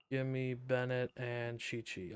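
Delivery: usual speaking voice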